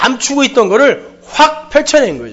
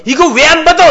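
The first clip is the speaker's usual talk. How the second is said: raised, indignant protest; angry retort; 6 semitones higher, 4 dB louder than usual. Retold or imitated. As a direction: demand it like a man insulted. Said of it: "이렇게 화를 내더라는 거예요". (억울해서 화내며 따지듯)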